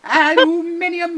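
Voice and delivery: aluminium with a high British lilt; British pronunciation. Retold or imitated. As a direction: say al-yoo-MIN-ee-um playfully. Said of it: "Uh, aluminium".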